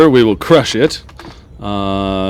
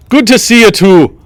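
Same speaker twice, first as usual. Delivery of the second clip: attempted Scottish accent; 11 semitones higher, 7 dB louder than usual. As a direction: greet them in a put-on Scottish brogue. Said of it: "talks in a Scottish accent".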